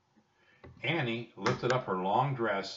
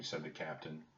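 ordinary delivery, nothing marked

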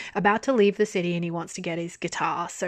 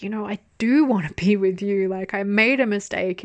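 normal talking voice

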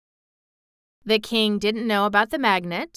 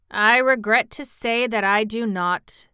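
plain reading voice

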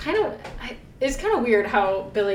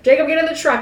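loud, higher command; stern call; 4 semitones higher, 6 dB louder, much faster than usual. Retold or imitated. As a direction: call the kid to the truck. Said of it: "Billy was like".

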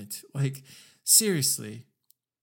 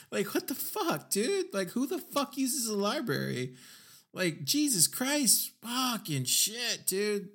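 high, disbelieving rant, pitched way up; exasperated outburst; 8 semitones higher, slower than usual. Real, not acted. Ranting at the SEC clip, high exasperated disbelief. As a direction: throw your hands up in disbelief, voice climbing.

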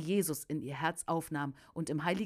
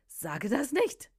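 slow, higher-pitched protest; demon's agitated protest; 6 semitones higher, 4 dB louder, slower than usual. A narrator's reading. Demon answers the preacher, protesting higher and slowly. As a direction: draw it out, pitched up in alarm.

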